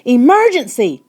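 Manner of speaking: a loud, high alarm cry; urgent shout. Acted. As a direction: sound the alarm. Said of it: "Patrick shouted".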